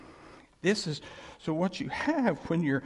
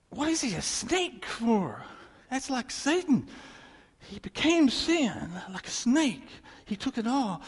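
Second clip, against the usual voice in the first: higher and slow with wonder; astonished; 7 semitones higher, slower than usual. Retold or imitated. Preacher voicing his wonder at the snake, slow astonished tone. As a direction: marvel aloud as it dawns. Acting out the amazed reasoning.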